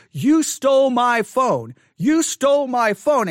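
high, loud, slow accusing yell; hammed-up accusation, drawn out; imitated yelling. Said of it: "And she's yelling at the".

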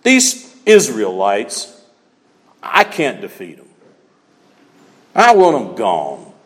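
a hint of a slow, low scheming king; slow, low menace as Balak; mild mock villain voice; lightly voiced king. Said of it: "Balak says".

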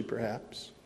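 normal talking voice

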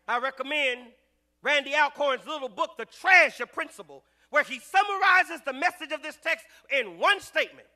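loud sermon delivery; preaching. Acted. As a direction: preach it firmly to the congregation. Preaching at full force.

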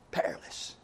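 "Perilous" softly whispered, no voice; whispered repeat. Shown as a demonstration. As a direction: breathe "Perilous" as a hushed warning. Repeats word, second whispered.

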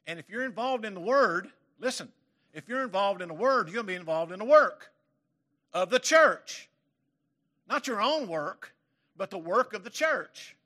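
loud, high fervent preaching; pulpit-pounding sermon voice; preaching at full force. Acted. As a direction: drive it home to the congregation, rising.

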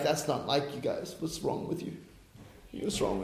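hushed, breathless mock whisper. Disciples whispering worriedly to the excited pair. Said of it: "and they're like".

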